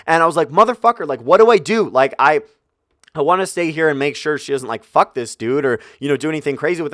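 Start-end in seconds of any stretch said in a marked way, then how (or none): 0.5-1.9 frustrated. Retold or imitated. Retold frustrated outburst before leaving.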